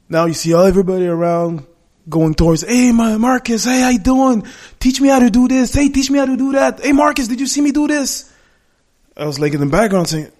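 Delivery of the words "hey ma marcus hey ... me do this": lightly voiced kids, pitched higher; a hint of excited kids crowding their idol; higher, keen kid chatter, lightly done; eager kids. Kids crowding Marcus asking for tips.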